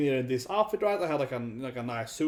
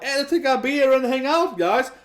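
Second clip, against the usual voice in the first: matey pub invite; 8 semitones higher, 4 dB louder than usual. Retold or imitated. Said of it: "He's kinda like".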